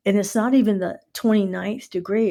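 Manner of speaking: ordinary delivery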